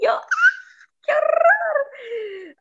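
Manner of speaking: high, drawn-out shriek of horror; horrified cry. Retold or imitated. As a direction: recoil in disgust and fear, stretching it out. Stranger tries to kiss her. (grito lento y agudo de horror)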